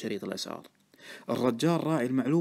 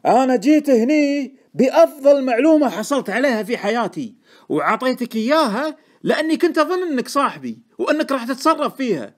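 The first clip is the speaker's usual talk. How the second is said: high, loud, heated reproach; furious; 13 semitones higher, 11 dB louder than usual. A narrator's reading. Informant reproaching Deacon for wasting his tip, furious. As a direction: rage at a friend who let you down. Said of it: "قال بغضب شديد".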